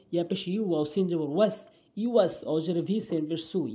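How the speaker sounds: ordinary talk, nothing marked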